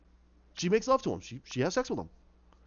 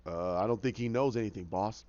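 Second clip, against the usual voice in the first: low, softened henchman voice; dim henchman; 8 semitones lower, 4 dB quieter than usual. Retold or imitated. Henchman answering the crime boss, low and hesitant.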